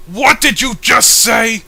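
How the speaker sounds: loud, slow, high shouted outrage; furious shout. Acted. Villain exploding at sensible advice, furious shout. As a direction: roar it at him, hammed-up.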